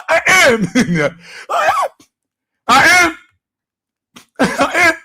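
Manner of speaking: high-pitched, between bursts of laughter; laughing insistence. Real, not acted.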